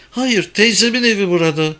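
put-on high-pitched girl's voice; young girl. A narrator's reading. Girl explains whose house it is, high young voice.

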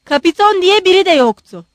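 loud, high, exasperated denial; thoroughly angry. A narrator's reading. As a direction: deny it hotly. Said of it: "Ganya büsbütün kızdı".

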